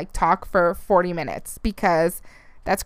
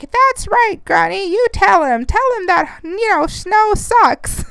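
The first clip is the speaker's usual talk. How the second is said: put-on high, slow old man voice, stretching out snow; old man impression; 10 semitones higher, slower than usual. Old man agreeing with her at Sprouts, high slow old voice. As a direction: cheer her on like a creaky grandpa, pitched way up. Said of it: "That's my impression of an old person".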